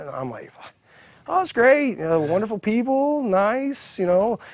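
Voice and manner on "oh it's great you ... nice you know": high, put-on interview pleasantness; sugary act over hidden annoyance; fake cheerful. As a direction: gush politely without meaning it. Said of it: "inside, I'm pissed".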